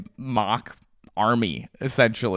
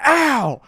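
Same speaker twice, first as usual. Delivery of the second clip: sudden high loud ow; sharp cry of pain, retold; pained yelp; 12 semitones higher, 6 dB louder than usual. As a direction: yelp like you just got shot in the leg. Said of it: "and I go".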